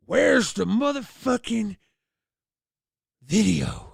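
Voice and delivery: dad demanding the tape, fuming; mock furious dad, words dragged out; angry dad. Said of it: "He was pissed".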